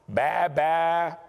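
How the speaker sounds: imitated bleating of sheep.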